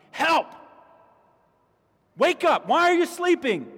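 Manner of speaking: high, loud cry for help; screaming to God. Acted. Congregation together crying out to God, desperate screaming. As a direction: shout it upward in desperation. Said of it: "screaming to God".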